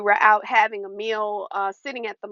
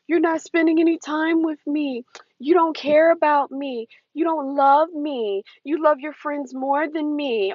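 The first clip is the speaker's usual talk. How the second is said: whiny guilt trip; 6 semitones higher than usual. Retold or imitated. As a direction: mimic the needy partner's complaint, stretched out. Quoted narcissist guilt-tripping.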